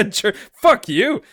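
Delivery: laughing, high fake-offended fuck you; laughing mock outrage. Real, not acted. Teased about being Jersey, laughing fuck you. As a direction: fire back through laughter.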